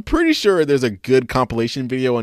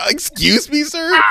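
high, raised outraged call; mock indignant shout; 11 semitones higher than usual. Speaker heckling the revived kid, mock indignant shout. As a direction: hams up disbelief at the kid.

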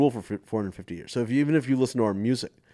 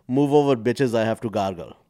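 louder, sassy nun's punchline; nun bragging to the other two nuns, louder; 2 semitones higher, 9 dB louder than usual. Acted. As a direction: belt the punchline out.

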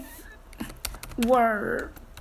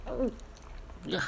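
disgusted groan; 11 dB quieter than usual. Real, not acted.

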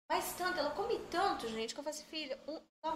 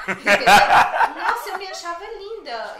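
laughing through the words, a bit louder; 14 dB louder than usual. Real, not acted. Laughing to friends about her bald photos. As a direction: giggle as you admit you felt pretty.

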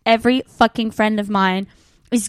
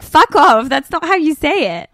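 loud, higher, comic indignation; mock outrage; 5 semitones higher, 5 dB louder than usual. Telling off Americans for their pronunciation, mock outrage. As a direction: snap it in exaggerated offence.

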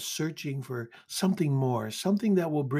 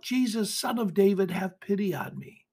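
high plea at normal volume; earnest, raised-pitch appeal; pleading cry; 7 semitones higher than usual. Acted. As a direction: cry out for mercy, gently. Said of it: "we cry out".

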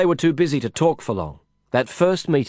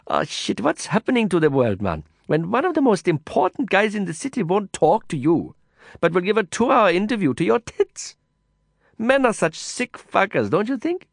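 put-on higher, exasperated young woman ranting; Kavita's voice, fed up; 8 semitones higher than usual. A narrator's reading. Kavita complains to a friend about the politician, higher and fed up. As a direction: vent your disgust at men.

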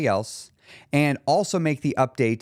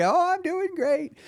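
grandma voice; slower than usual. Retold or imitated.

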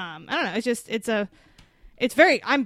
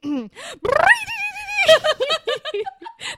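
high loud squealing cry; shrieking cartoon sound; sound effect; 6 dB louder than usual. Shown as a demonstration. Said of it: "That was a different one".

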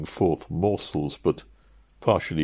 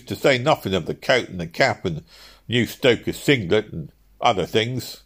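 raised, cockney shopman's flattery; counterfeit admiration; 5 semitones higher, 4 dB louder than usual. Acted. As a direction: gush with fake admiration over the bargain. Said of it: "with a counterfeit admiration".

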